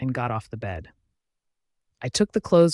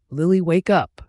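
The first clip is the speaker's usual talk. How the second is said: mild yell, higher and louder; urgent yell; 4 semitones higher, 6 dB louder than usual. A narrator's reading. A voice rouses Lily from her nightmare, mild yell. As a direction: raise your voice to wake a sleeper. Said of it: "the voice yelled again".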